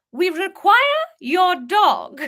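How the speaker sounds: menacing Queen demanding the dog; posh English Queen. Acted. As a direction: deliver it like a grand sinister royal. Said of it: "as the Queen".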